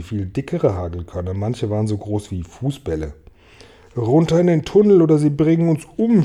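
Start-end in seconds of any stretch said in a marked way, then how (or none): 4.0-6.2 high, loud cry to take cover; urgent shout. A narrator's reading. James urges friends into the tunnel, loud high shout. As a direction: yell it to get everyone moving. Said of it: "schrie James".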